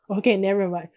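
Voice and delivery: ordinary talk, nothing marked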